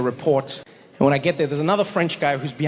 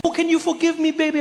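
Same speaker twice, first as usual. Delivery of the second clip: very high, begging mother voice; pleading mom; 12 semitones higher than usual. Acted. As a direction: beg your child in a hammed-up high voice. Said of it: "when she messed up".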